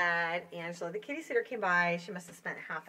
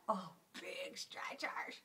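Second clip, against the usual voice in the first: slow, soft unvoiced whisper; 7 dB quieter, much slower than usual. Real, not acted. Sitter whispering as the cat stretches. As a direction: breathe it out softly over the stretching cat.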